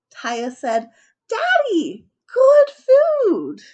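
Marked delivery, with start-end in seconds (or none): 1.3-3.6 put-on high, loud little-girl voice; very high, very loud kid squeal; child shout. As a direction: shout it proudly, hammed-up child. Child pleads to father.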